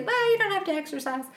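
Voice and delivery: high, quick imitated naysayer; mock objector. Podcaster quoting people who say skip exercise, mock objection. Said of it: "People will say".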